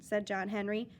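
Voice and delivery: plain reading voice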